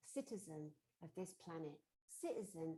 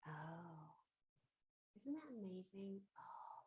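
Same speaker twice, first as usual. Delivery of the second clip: ordinary delivery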